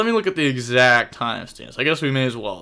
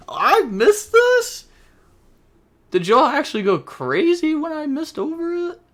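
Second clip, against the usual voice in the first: can't believe you missed this, voice soaring; shocked disbelief; 5 dB louder than usual. Real, not acted. Reacting to chat he missed.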